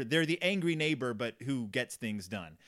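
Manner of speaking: normal talking voice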